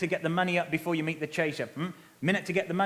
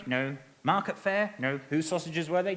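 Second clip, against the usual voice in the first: ordinary talk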